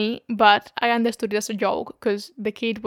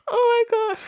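squealed, much higher, disbelieving; shocked; 12 semitones higher, 3 dB louder than usual. Real, not acted. Host reacting to a listener's story, shocked high squeal. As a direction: react as if it can't be real.

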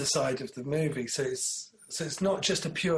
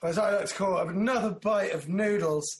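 ordinary delivery

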